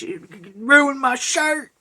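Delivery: high, slow mock meltdown cry; Nicolas Cage. Mimicking the Mandy meltdown, mock unhinged cry. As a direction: drag it out as a hammed-up high wail. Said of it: "Like, uh, Mandy with, uh, Nicolas Cage".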